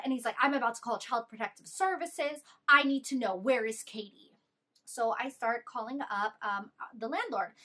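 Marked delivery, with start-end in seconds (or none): 0.4-4.4 angry threat. Retold or imitated. Retelling the boyfriend threatening the roommate, loud angry demand. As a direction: push hard like you're about to call the cops.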